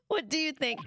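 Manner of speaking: light attempt at a British accent; half-hearted British accent. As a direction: try a posh-ish British detective line. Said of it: "I can do a British accent today".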